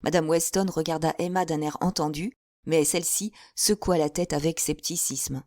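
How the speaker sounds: ordinary delivery, nothing marked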